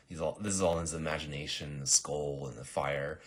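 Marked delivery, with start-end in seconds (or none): none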